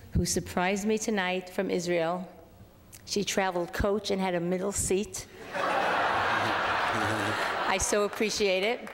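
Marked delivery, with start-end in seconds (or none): 5.4-8.9 laughing through the thanks; slow, laughing gratitude. Real, not acted. Sister traveled coach, joke.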